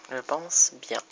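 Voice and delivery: normal talking voice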